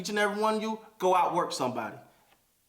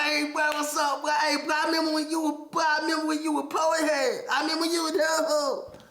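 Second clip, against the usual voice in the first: mock hater; 7 semitones higher than usual. Imitating haters who bring up where he came from. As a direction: ham up the nosy hater's greeting. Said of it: "people be like".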